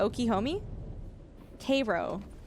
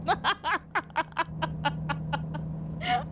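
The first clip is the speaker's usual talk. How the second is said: laughing; 5 semitones higher than usual. Real, not acted. Laughs after joke.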